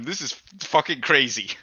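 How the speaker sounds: ordinary delivery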